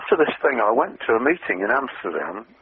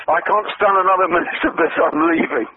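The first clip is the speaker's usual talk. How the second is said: retold loud outburst, pitched higher; mock David storming out of a dull meeting, raised voice; 7 semitones higher than usual. David interrupting the presenters, retold higher and a bit louder. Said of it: "David said in a very loud voice".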